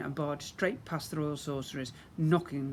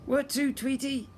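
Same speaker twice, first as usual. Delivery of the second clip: high, loud cackle; cackling fairies; 10 semitones higher, 5 dB louder than usual. Acted. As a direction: cackle the question. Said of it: "they cackled".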